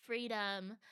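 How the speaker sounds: usual speaking voice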